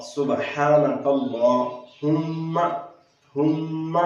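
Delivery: slow सुबहानकल्लाहुम्मा with the ह joined to a long म; careful Quran recitation; slow correct recitation. Shown as a demonstration. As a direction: draw out हुम्मा, ह linked to म. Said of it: "हा को मीम के साथ मिलाना है".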